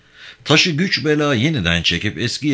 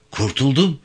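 first cry of relief, raised pitch; higher-pitched exclamation, not yet a full shout; exclaiming; 5 semitones higher than usual. A narrator's reading. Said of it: "diye kollarını kaldırarak bağırmaya".

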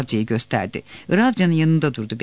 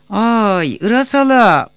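high, loud, drawn-out call; shouted call; 6 semitones higher, 7 dB louder, slower than usual. A narrator's reading. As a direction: holler to wake someone inside. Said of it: "Bir daha bağır". (yüksek sesle uzata uzata bağırma)

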